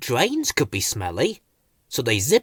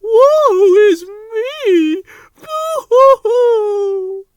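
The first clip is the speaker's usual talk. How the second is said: very high, slow loud boo-hoo wail; sobbing lament; 9 dB louder, much slower than usual. A narrator's reading. Rose crying over her trouble, high wailing sobs. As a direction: melodramatic sobbing. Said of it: "came Rose's voice".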